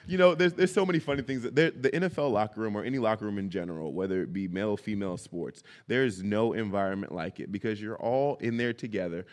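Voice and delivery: ordinary talk, nothing marked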